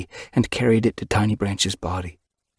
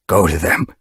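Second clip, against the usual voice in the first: a louder, hoarse growl of grief; 5 dB louder than usual. A narrator's reading. Grieving leader sends her to her kits, hoarse growl. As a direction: bark it out hoarsely, cutting her off. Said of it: "he growled hoarsely".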